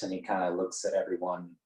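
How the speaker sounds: normal talking voice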